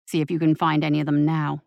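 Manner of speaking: growl. Acted. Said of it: "He growled".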